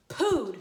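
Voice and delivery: "Pood" said with a popped P, no F; deliberate mistake. Shown as a demonstration. Teacher quizzing kids, wrong "Pood" again. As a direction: repeat "Pood" with a clear P burst. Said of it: "thumbs up or a thumbs down?".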